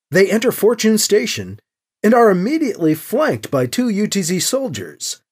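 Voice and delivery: ordinary delivery, nothing marked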